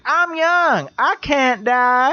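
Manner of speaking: high, drawn-out mock plea; imitated recorded cry. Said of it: "it's all like".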